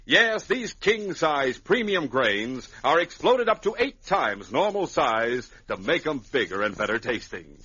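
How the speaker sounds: put-on bright sales pitch to the kids; upbeat cereal commercial voice; ad read. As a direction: sell it like a radio announcer. Announcer reads cereal ad.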